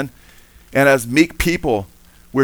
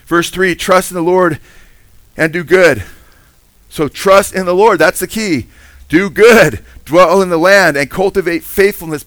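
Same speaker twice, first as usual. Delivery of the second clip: high, loud, driving sermon voice; preaching at full force; 6 semitones higher, 5 dB louder than usual. Acted. Pastor urging congregation to trust the Lord, loud preaching. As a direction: press each verse home to the congregation.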